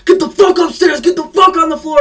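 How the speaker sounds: mimicking a SWAT officer screaming orders; imitated SWAT yell. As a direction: shout it like the armed officer in the doorway. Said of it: "and he said".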